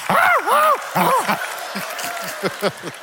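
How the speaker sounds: high-pitched mock barking; dog bark. Preacher leading the church on count of three, dog barks. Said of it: "let's bark like a dog".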